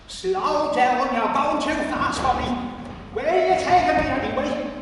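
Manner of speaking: put-on old Pat fretting as he's led down; crotchety old man. Nervous old man being guided, querulous.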